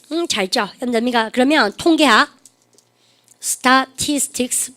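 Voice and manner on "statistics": STATISTICS very slow, a gap after each syllable. Shown as a demonstration. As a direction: dictate "statistics" piece by piece. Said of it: "발음 갖다 서 statistics".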